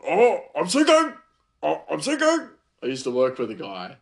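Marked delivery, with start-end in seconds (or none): 0.1-2.5 playful high sinking cry; joking yelp when busy; mock panic. Teller snowed under at work, mock panic cry. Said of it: "anytime I'm snowed under".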